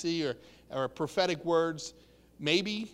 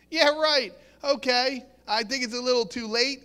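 mock scoffing disbelief; Sarah; 4 semitones higher than usual. Preacher acting Sarah doubting she can have a child, scoffing. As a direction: toss it off as a laughable idea, pitched up. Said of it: "looked and laughed".

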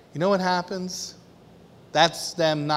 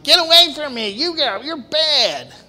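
high, loud mock angry child voice; hammed-up pouty scolding; imitating Abba mad; 10 semitones higher, 7 dB louder than usual. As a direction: whine it like a teased girl pushing away. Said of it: "she'll be like".

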